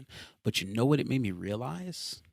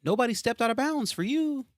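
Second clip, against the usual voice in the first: usual speaking voice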